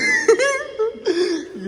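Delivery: shrieking laugh; real laugh, high and loud; high laughing fit. Real, not acted. Laughing at friend's answer. (ज़ोर से हँसी)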